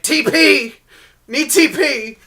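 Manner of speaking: high piercing yell; shouted quote. Retold or imitated. Quoting Jason's toilet paper clip.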